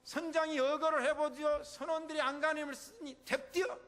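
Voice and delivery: Jeolla dialect slip. Acted. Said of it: "전라도 말이 나와버렸네".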